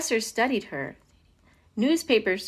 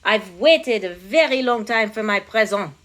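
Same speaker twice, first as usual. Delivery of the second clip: put-on loud French-accented complaint; demanding French king; 7 dB louder than usual. A narrator's reading. King to counselor after waiting, complaining French accent.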